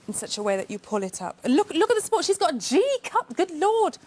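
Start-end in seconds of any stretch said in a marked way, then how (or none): 1.4-4.0 high-pitched, exclaiming astonishment; mock amazement. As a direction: marvel out loud, pitched well up. Mock amazement at cup size.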